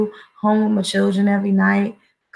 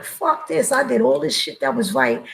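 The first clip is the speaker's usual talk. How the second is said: pitched-up, bitter quoted burst; inner outburst; 6 semitones higher than usual. Retold or imitated. Father recalling losing his kid, bitter outburst. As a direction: spit out the frustration you felt then. Voicing his own anger at injustice.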